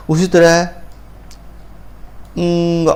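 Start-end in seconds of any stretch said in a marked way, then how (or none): none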